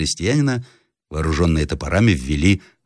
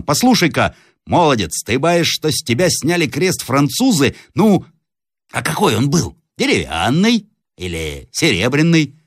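higher-pitched, probing peasant voice; questioning peasant; 8 semitones higher than usual. Acted. Peasant Ivan quizzing the captive about his cross, probing role voice. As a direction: test his story point by point.

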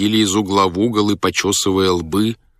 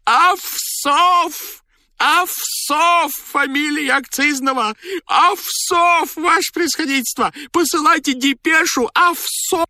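extremely high, drawn-out ecstatic shriek; elated squeal, not your own voice; joyful frantic cry; slower than usual. A narrator's reading. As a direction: cry out the news in wild triumph. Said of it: "закричал он радостно, не своим голосом".

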